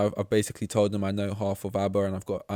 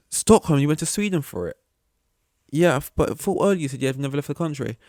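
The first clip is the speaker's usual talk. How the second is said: suspicious stranger; 5 semitones higher, 6 dB louder than usual. Retold or imitated. Stranger questioning the lie.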